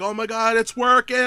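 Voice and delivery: high, loud thrilled exclamation; mock elation. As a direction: act out an inventor's breakthrough moment. Acted inventor thrilled at success.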